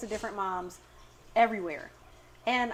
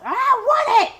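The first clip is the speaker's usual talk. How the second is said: put-on high, loud child's tantrum cry; mock tantrum whine; 13 dB louder than usual. Mom acting out her kid's supermarket tantrum, high and loud. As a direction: wail it like a toddler refusing.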